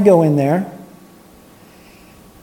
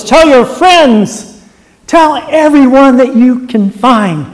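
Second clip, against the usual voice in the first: loud, high, ringing sermon voice; preaching at full force; 4 semitones higher, 6 dB louder than usual. Acted. Preacher urging the congregation to spread the news, preaching loud. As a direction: rouse the church, full throat.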